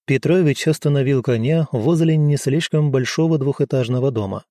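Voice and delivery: ordinary delivery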